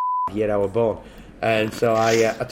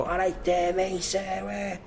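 put-on Scouse accent; 9 semitones higher, much slower than usual. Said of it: "he'd be all".

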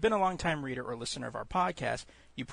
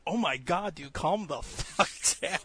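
exasperated; 5 semitones higher, slower than usual. Retold or imitated. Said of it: "And I'm, like, going".

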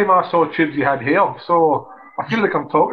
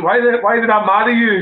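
higher, louder exasperated outburst; half-hammed frustrated husband; mock exasperated; 7 semitones higher, 3 dB louder than usual. Husband snapping at his wife on a bad day, mock exasperated. Retold marital row.

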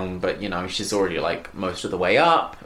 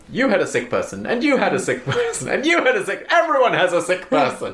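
laughing mockery; 8 semitones higher than usual. Real, not acted. Mocking the film's repetition.

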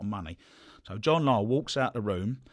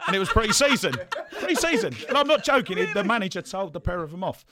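laughing; 13 semitones higher, 6 dB louder than usual. Real, not acted. Laughing at his own pre-season story.

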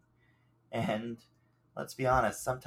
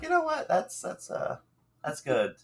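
sudden high-pitched eureka; excited realization; 9 semitones higher than usual. Retold or imitated. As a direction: pitch jumps way up with delight. Retold self finding the topic.

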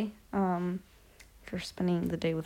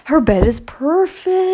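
loud mock protest; 7 semitones higher, 13 dB louder, much slower than usual. Real, not acted. Teasing sister about messy bed.

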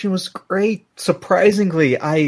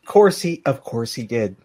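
ordinary talk

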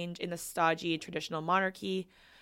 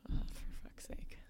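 whispered, exasperated aside; 11 dB quieter than usual. Real, not acted. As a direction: whisper it in exasperation, under your breath. Exasperated aside on coworkers' cluelessness.

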